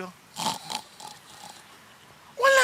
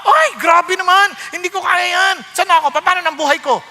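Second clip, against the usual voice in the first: raised, loud objection; mock angry protest; 5 semitones higher, 5 dB louder than usual. Preacher acts someone refusing "cut it off", loud and indignant. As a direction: balk indignantly at a hard command. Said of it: "Nagagalit ka agad".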